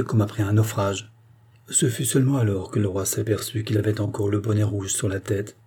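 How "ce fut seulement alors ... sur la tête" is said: plain reading voice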